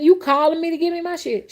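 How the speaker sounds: very high, loud, incredulous demand; indignant inner thought. Retold or imitated. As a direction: ask where your money is, outraged. Inner thought, expecting payment.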